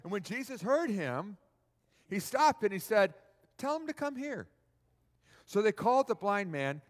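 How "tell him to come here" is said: calm summons. Acted. Voicing Jesus stopping to call.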